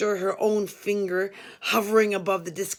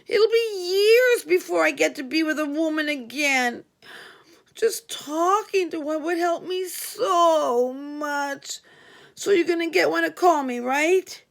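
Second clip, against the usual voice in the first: much higher, louder, tearful pleading; begging Frankie; 8 semitones higher, 4 dB louder than usual. Retold or imitated. Inmate Frankie begging the narrator to find him a woman to talk to. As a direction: beg as if close to tears. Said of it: "He was almost begging".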